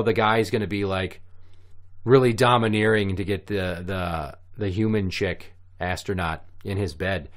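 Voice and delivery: ordinary delivery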